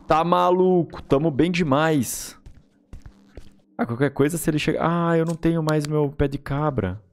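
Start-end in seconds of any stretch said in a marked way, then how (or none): none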